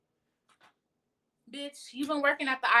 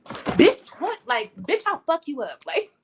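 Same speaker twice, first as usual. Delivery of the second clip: higher, louder hammed-up outburst; mock furious threat; 7 semitones higher, 6 dB louder than usual. Defending yourself against haters, hammed-up angry threat. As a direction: snap back like you're ready to fight. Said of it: "That's how you should feel".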